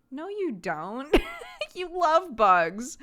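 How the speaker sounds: slow, loud, high teasing contradiction; playful insistence. Retold or imitated. Mother teasing her daughter that she loves bugs, slow and loud. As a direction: drag it out and lean on love.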